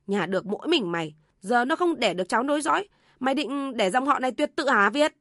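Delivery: loud, high-pitched berating mother; angry yelling. A narrator's reading. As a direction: rail at the disobedient son at full voice. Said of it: "Bà Tâm gào lên". (mẹ gào lên mắng con trai)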